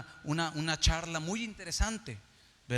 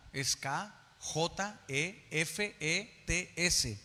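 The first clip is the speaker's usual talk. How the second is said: usual speaking voice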